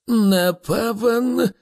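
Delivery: high, slow, drawn-out musing; absent-minded. A narrator's reading. Slartibartfast glances up from his instrument, absent-minded. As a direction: trail the words, preoccupied. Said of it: "неуважливо відповів Сларті Бартфаст".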